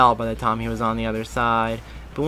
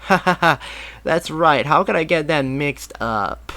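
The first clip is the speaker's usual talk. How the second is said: loud fake ha-ha; forced laughter; 4 semitones higher, 5 dB louder than usual. A narrator's reading. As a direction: laugh it off awkwardly, faking it. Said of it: "forcing his laughter".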